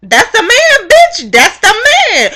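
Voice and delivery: very high, loud squealing outrage; outraged shriek. Acted. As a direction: shriek the reveal in disbelief. Outraged reveal of a cast member.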